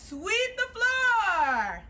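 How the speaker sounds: slow called-out command. Shown as a demonstration. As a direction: stretch it out like a drill call. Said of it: "Number two is".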